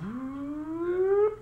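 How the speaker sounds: rising slow whoop like a charging flash; camera flash whine. Shown as a demonstration. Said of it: "What sound does it make".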